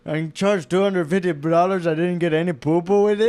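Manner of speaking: put-on Indian accent, hammed-up; complaining customer. Customer complaining about the bill, in a put-on accent. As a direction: protest in a cartoon Indian accent. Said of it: "you're looking at the bill and you're like".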